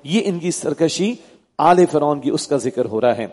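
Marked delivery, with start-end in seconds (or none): none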